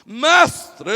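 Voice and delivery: loud, theatrical call; oratorical legal expert. Acted. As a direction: proclaim it grandly to a crowd. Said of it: "ele discursa assim". (chamado alto de orador teatral)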